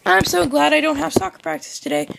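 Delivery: very high, squeaky delivery; high-pitched silly voice. Pretend. Gamer glad to skip soccer practice, high silly voice. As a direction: pipe it up an octave.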